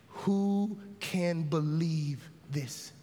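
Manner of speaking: very slow, low and hushed; stunned, softly drawn-out question; slow disbelief. Acted. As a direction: ask it heavily, one word at a time. Disciples' disbelieving question, acted.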